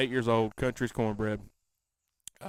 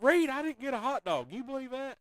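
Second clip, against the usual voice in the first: mock complaint; 12 semitones higher than usual.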